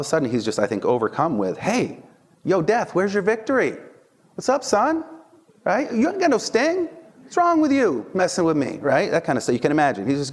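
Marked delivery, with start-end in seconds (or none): 1.6-8.8 trash talk. Retold or imitated. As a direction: taunt death like a cocky winner, way up high. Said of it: "he starts talking trash".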